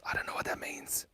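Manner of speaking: breathy secretive whisper; a hushed joke whispered to the viewers. Real, not acted. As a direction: lean in and whisper it. Whispers about the drawing.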